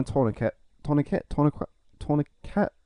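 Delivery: "tourniquet" tried several ways, questioningly; struggling to pronounce. Real, not acted. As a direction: feel out "tourniquet" uncertainly, varying the last syllable. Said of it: "I can't pronounce it".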